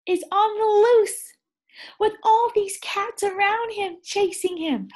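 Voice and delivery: high and loud, rising alarm; excited. Real, not acted. Reader tells the children the mouse is loose, high and excited.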